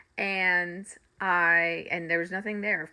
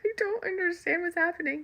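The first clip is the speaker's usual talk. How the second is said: baffled squeal; 12 semitones higher, 3 dB quieter than usual. Retold or imitated. Retelling confusion about spirits.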